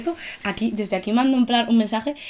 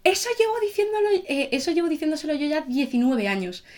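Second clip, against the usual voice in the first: higher-pitched mock indignation, starting sharply raised; mother's indignant retort; 6 semitones higher than usual. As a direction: act out mum setting him straight.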